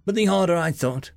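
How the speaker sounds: ordinary delivery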